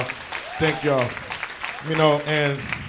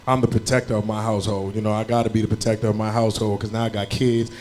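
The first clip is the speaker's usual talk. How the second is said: usual speaking voice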